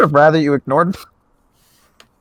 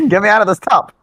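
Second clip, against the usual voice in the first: higher put-on Taft voice; 8 semitones higher, 4 dB louder than usual. Podcaster voicing President Taft in a bathtub, higher put-on voice. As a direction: complain loudly from the tub as Taft. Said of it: "Just say the phrase".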